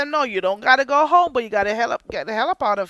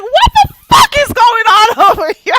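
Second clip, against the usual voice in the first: shocked shout; 13 semitones higher, 13 dB louder than usual. Retold or imitated. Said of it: "And I'm like".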